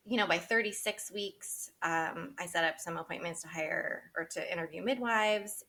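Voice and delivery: ordinary talk, nothing marked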